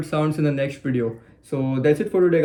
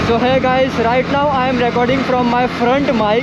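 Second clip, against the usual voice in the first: loud voice over wind noise; speaking loudly; 9 semitones higher, 6 dB louder than usual. Real, not acted. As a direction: raise your voice over the wind. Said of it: "I have to speak very loudly".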